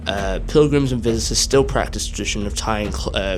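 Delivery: projected, low travel-video narration; documentary voice-over. Real, not acted. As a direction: narrate the site's history to viewers. Recorded voice-over about the site.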